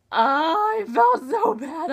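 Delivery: slow, teary "I felt so bad"; voice breaking. Real, not acted. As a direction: let the voice crack with real sympathy. Upset over the heroine's betrayal.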